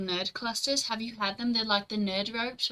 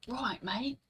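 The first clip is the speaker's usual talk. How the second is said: soft, higher-pitched Australian accent; 3 semitones higher, 4 dB quieter than usual. Shown as a demonstration. Streamer demonstrates the one accent known, Australian accent. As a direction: put on an Australian accent, quietly. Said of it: "none of them except for this one. Australia".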